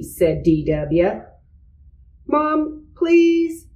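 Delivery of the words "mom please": put-on high pleading child voice; begging. A narrator's reading. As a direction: beg Mom, high and pleading. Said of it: "begged Arthur".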